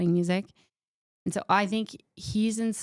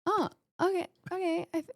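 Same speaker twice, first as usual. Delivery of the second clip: a high, baffled "oh, okay... what?"; confused surprise; 12 semitones higher, faster than usual. Retold or imitated. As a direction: act out being caught off guard, pitch rising. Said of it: "they're really going".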